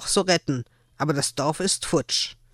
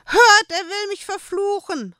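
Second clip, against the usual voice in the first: sehr hoch und laut gejammert; heulend. Acted. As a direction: jammer angstvoll um Hilfe. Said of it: "heulte Hanne". (Hanne jammert die Burgbewohner ängstlich an)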